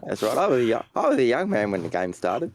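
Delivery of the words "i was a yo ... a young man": laughing. Real, not acted. Joking about being old.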